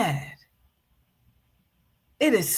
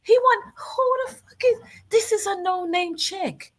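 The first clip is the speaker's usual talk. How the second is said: outraged disbelief; 9 semitones higher than usual. Real, not acted. Outraged reaction to girlfriend clip.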